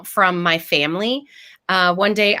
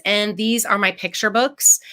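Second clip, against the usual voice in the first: usual speaking voice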